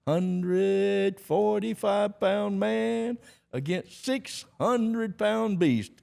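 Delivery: very high, loud, drawn-out barker cry; mocking showman announcement. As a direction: stretch each word out to mock the lightweight. Barker mocks the skinny challenger.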